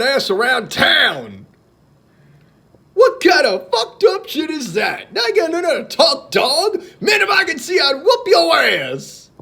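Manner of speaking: high, loud, shouted stand-up delivery; punchy, high-pitched comic rant; Chris Rock. Retold or imitated. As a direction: holler it like a riled-up comedian. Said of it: "This one is from Chris Rock".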